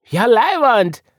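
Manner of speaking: high, loud gleeful cheer; joyful reply. A narrator's reading. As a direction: shout back with delight. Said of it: "gab Woggle freudig zurück".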